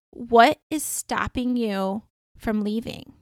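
slow, deliberate, spaced-out question; slow pointed question. Retold or imitated. Dreamer confronting a woman about her bad relationship, slow and pointed. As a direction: ask it firmly, stretching the words.

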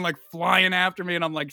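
usual speaking voice

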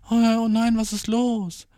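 high-pitched worried imitation; mock concern. Date who thinks he is depressed, concerned.

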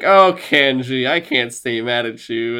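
slow, drawn-out mock fond scolding; hammed-up catchphrase delivery; sitcom forgiveness. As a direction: stretch it like a sitcom line. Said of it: "they can get angry at him and then be like".

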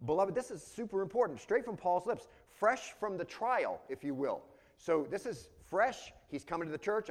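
emphatic preaching. Real, not acted. Preacher tells the beloved this is super important, emphatic preaching. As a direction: drive it home to the congregation.

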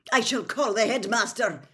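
loud, high, stern warning; shouted threat. A narrator's reading. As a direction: shout it up at a troublemaker. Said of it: "shouted Professor McGonagall".

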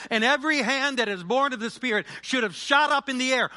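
high, loud fiery sermon; preaching at full force. Real, not acted. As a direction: preach it with fire.

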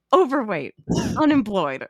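giggling through the list; can't keep a straight face reading the clues; laughing quote. Retold or imitated. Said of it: "she's like".

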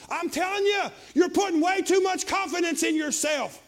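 loud, high, rising preacher's cry; preaching at full force. Acted.

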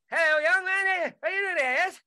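put-on a light jokey English accent, chatty and helpful; mock English accent. Englishman kindly redirecting a lost stranger, mock English accent. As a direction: play a cheery English homeowner giving directions. Imitating polite English homeowner.

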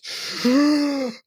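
tight, strained gasp through a closed throat; tense gasp. Shown as a demonstration. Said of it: "if you breathe like this".

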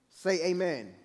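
normal talking voice

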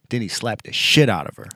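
usual speaking voice

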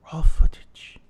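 loud accented stage whisper; pushed whisper. Shown as a demonstration. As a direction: push the whisper harder. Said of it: "so let me try this again".

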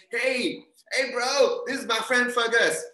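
high, loud introduction; hearty party hail. Acted. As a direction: call over a bro to meet your friend. Acted friend hailing someone at party.

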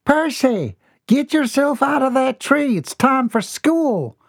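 mother calling her son down for school. A narrator's reading. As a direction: shout up into the tree.

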